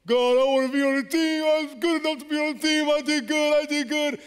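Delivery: high, loud anguished plea; pleading prayer. Retold or imitated. Cut player begs God, anguished loud plea. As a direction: cry out to God, hurt and desperate.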